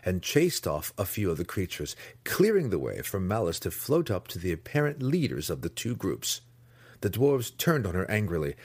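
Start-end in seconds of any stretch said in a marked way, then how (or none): none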